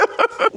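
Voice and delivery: short high giggle; shy little laugh; awkward giggle. Real, not acted. Awkward on camera with Matthew.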